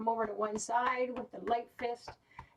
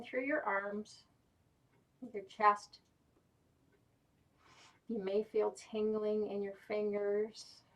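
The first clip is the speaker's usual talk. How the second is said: guided meditation; 3 semitones lower than usual. Acted. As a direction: guide them gently inward. Guided relaxation.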